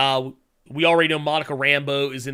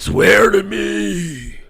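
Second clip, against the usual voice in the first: slow hoarse shouted Batman growl; mocking the raspy movie Batman; 5 semitones higher, 8 dB louder, much slower than usual. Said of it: "he doesn't have to talk like that".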